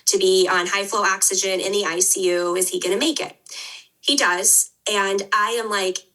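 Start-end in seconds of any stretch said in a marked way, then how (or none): none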